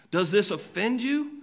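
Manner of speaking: "offend" stretched, question lifted high and slow at "you"; pointed challenge. Acted. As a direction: ask it as Jesus, a pointed challenge. Preacher voicing Jesus' challenge.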